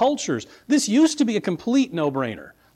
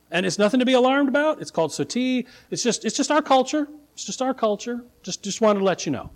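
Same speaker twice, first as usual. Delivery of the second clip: higher, casual reassurance; breezy downplaying; 7 semitones higher, faster than usual. Preacher voicing locals excusing their custom, breezy downplaying. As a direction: wave it away as perfectly normal.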